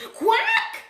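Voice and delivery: loud, high quacking cry; enraged quack. A narrator's reading. Missus Duck spotting Big Bad Wolf, furious loud quack. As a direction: quack out in outrage. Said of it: "Missus Duck flew into a rage".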